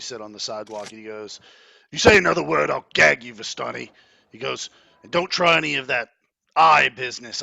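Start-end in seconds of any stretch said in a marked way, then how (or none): 1.9-7.4 loud, menacing warning; guard threatening the bound prisoner, loud and menacing. Acted. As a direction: growl it down at the prisoner.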